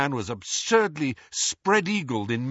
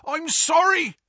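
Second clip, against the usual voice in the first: high, raised-voice moan of apology; distressed, pitched-up lament; 12 semitones higher, 4 dB louder than usual. A narrator's reading. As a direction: wail the apology as Hagrid. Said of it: "moaned Hagrid".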